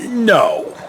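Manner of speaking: ordinary delivery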